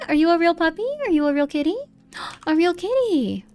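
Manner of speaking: high, delighted cooing with rising questions. Real, not acted. Streamer greeting an in-game puppy and kitty, high baby talk. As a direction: coo sweetly at a puppy and kitty.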